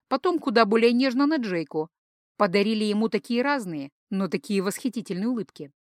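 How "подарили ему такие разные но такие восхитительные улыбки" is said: ordinary delivery, nothing marked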